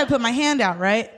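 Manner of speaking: usual speaking voice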